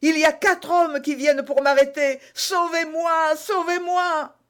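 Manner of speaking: high, loud, desperate plea; panicked cry. A narrator's reading. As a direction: beg loudly to be rescued, drawing out the final cries. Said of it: "s'écria-t-il". (supplication affolée, criée dans l'aigu)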